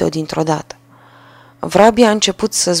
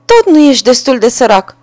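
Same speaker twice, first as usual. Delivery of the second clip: mocking sparrow; 5 semitones higher, 6 dB louder than usual. A narrator's reading. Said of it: "a spus Vrabia".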